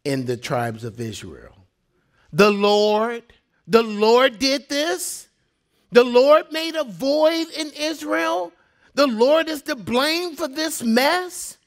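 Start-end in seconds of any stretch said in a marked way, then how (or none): 2.3-11.6 rising, disbelieving questions; higher pitch, indignant disbelief; incredulous. Acted. Preacher questions blaming the Lord, incredulous.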